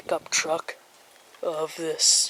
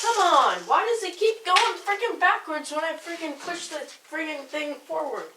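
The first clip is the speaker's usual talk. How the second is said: frustrated; 10 semitones higher than usual. Real, not acted. Drone won't fly right.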